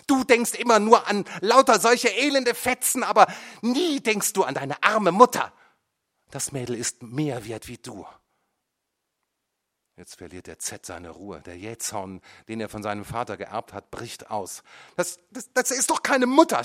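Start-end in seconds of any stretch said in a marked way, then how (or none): 0.1-8.1 high, loud, hysterical rant. A narrator's reading. Mother rages hysterically at her son. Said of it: "wird immer hysterischer". 15.0-16.6 high, loud, stammering shout. A narrator's reading. Son shouts at his mother in court. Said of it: "schreit er".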